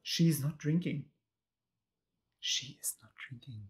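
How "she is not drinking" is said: hushed unvoiced whisper. Real, not acted. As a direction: whisper it like a secret. Repeats the line as a whisper.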